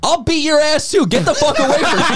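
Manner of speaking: high, hammed-up bouncer threat; mock bouncer threat. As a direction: snarl it like a huge guard losing patience. Voicing the huge guard.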